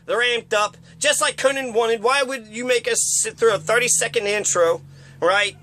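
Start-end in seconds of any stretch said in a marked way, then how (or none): none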